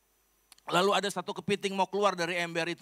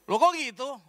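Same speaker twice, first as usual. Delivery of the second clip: shocked; 10 semitones higher, 7 dB louder than usual. Retold or imitated. Learns the powder is vitamin C.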